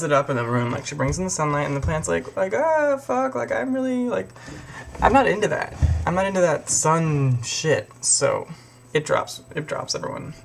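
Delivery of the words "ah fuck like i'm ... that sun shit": whiny plant. Retold or imitated. Plant voiced hating sunlight.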